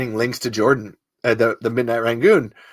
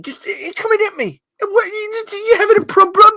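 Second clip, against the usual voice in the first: much higher, loud accusing voice; Cal Lightman impression; 5 dB louder than usual. Podcaster as the TV lie detector, loud and much higher. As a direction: accuse them, reading every lie. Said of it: "I'm Cal Lightman".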